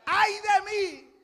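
slow, high, loud cry of woe; Isaiah's lament. Acted. Preacher voicing Isaiah's cry to the church, slow wail. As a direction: stretch it out like a prophet's wail. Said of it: "usted puede decir amén".

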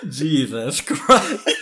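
slow shocked laughing. Real, not acted. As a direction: laugh it out in disbelief. Shocked at dark joke.

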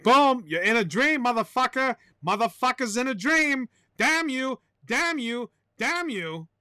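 playful tirade that fades at the end; mock explosion. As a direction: curse in a hammed-up high voice. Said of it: "I didn't mean to explode right there".

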